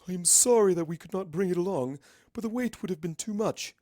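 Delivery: the princess apologizing in a formal royal voice; Princess Luna voice. Acted. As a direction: speak with stately old-fashioned dignity.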